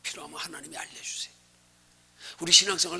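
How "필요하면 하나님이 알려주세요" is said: soft, hushed reassurance; breathy hush to the congregation; whispered promise. Acted. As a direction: lean in and whisper it. Preacher whispers the same promise.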